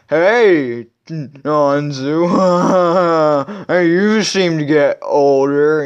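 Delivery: hammed-up old geezer voice with a laugh; drawn-out, silly old-man impression; creepy old man. Grandfather greets the visitors, hammed-up creepy old man. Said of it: "you gotta have an old person".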